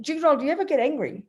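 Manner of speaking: ordinary talk, nothing marked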